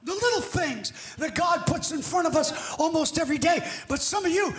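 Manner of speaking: full-force preaching. Real, not acted. Preacher urges the congregation to answer God's call today, full-force preaching. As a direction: drive it home to the congregation, shouting.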